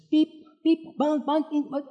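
high-pitched beeps and bongs; nagging gadget noises, voiced high; imitated phone alerts. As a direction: mimic a barrage of electronic pings, high. Imitates technology noises nagging for attention.

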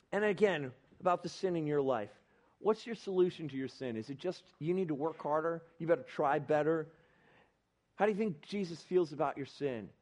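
ordinary delivery

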